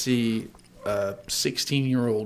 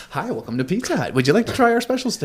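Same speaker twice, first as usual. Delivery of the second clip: high, fast chirpy waitress greeting; cheery server voice; 8 semitones higher, faster than usual. Retold or imitated. Teen server greeting customers, high chirpy fast. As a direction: switch on the fake-bright server smile. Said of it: "and go".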